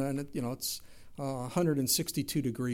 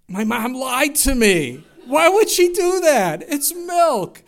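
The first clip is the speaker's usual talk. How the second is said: much higher, louder and slow, laughing disbelief; preacher exclaiming at his mother's milk lie, loud laughing outrage; mock outrage; 10 semitones higher, 13 dB louder, slower than usual. Real, not acted. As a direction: cry out the betrayal, half laughing.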